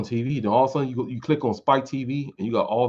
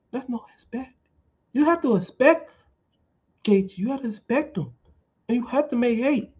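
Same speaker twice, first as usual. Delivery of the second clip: much higher, thin voice all through; high-pitched Anderson Silva. Retold or imitated. Mocking Anderson Silva's high voice about making weight. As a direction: squeak it out like a tiny-voiced fighter. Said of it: "he got that high-pitched voice".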